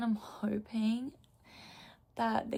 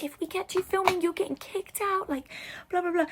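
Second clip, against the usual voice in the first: mock strict staff; 9 semitones higher than usual. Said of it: "they're like".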